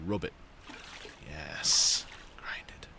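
whispered, savouring urging; mock creepy whisper. Player urging someone to grind it, creepy whisper. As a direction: hiss it softly with mock relish.

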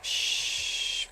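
soft shh; hushing. Real, not acted. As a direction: hush to keep hidden. Hushing while hiding.

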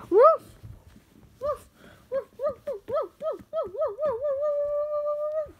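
high, loud yappy barks; dog barking. Acted. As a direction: bark like a little puppy. Kid voicing a dog character.

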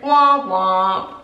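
put-on toddler-style dismissive womp. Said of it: "And she went".

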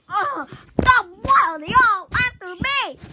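high, loud, pleading; distressed cry. Acted. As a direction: cry out being chased. Distressed cry while chased.

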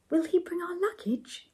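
mildly nervous high-pitched question; a touch of worry, pitch lifted high. A narrator's reading. Ethel asks about the luggage, mildly nervous. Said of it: "asked Ethel nervously".

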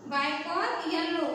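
ordinary delivery

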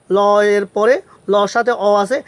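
ordinary delivery

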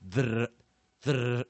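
嘚儿 as a loud rolled tongue-tip trill; Spanish trilled R. Shown as a demonstration. Teacher showing the Spanish origin of R, trilled 嘚儿. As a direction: roll the R hard on 嘚儿. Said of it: "它的发音就是".

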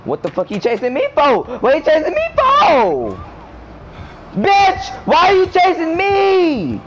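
high, loud protest building to a shout; escalating high-pitched outcry; panicked yelling. Real, not acted. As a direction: yell it at the pursuer, stretching the ends. Chased by the killer.

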